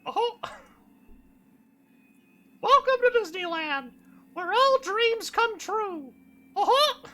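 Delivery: very high squeaky cartoon mouse; chirpy theme-park mascot; Mickey Mouse voice. Voicing the Disney mouse.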